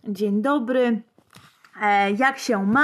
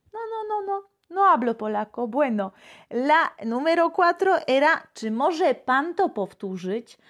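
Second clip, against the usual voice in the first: ordinary talk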